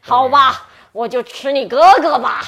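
a hint of a greedy troll. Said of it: "那这个大丑怪就说：".

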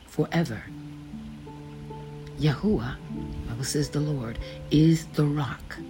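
ordinary delivery

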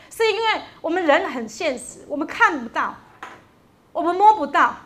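impassioned preacher pressing each point; preaching. Acted. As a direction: drive it home to the congregation. Preaching with rising fervor.

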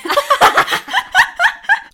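a long, loud, high burst of laughter. Real, not acted. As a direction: crack up at your own memory. Bursts out laughing at own story.

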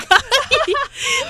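a bright peal of laughter; high laugh. Real, not acted. Cohost laughing at the mother's idiom joke, high.

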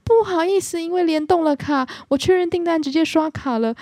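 high, tense apology to the shop; apologising to customer service, nervous high apology. Retold or imitated. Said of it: "然后我就很紧张".

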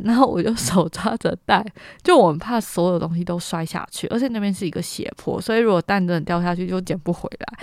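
ordinary delivery

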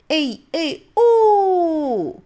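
high, loud, slow cheer; rallying cheer. Acted. Child cheering herself on before building, high and loud.

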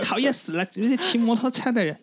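put-on high shrill whiny old grandma; shrill palace servant. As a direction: squeal it like a fussy old eunuch. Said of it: "你为什么要学一个公公？".

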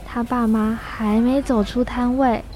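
ordinary talk, nothing marked

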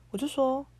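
normal talking voice

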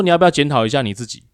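fast, pointed question; quick jab. Retold or imitated. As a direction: fire the retort off quickly. Quoted jab at coworker. (快速地反问对方)

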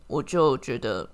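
ordinary talk, nothing marked

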